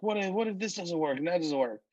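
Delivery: high, rushed worrying; mock freaking out. Susan frets to Kimberly about the plan, mock freak-out. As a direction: imitate someone panicking, words tumbling out high and fast. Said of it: "And Susan's freaking out".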